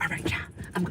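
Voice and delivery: hushed, unvoiced and nervous; Peter's whisper. Peter whispering as he steps onto the water. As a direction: whisper it, half daring himself. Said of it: "So Peter was like".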